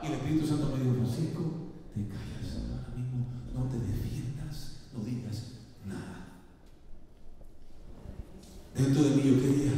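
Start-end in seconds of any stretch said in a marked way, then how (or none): 1.0-6.4 hushed, slow and much softer; quiet voice of the Holy Spirit; soft inner voice. Retold or imitated. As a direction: speak it low as a gentle inner command. Holy Spirit speaks to him.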